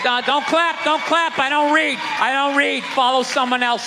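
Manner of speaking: loud, high mock preacher barking orders. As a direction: ham up a bossy preacher. Mocking church preacher voice.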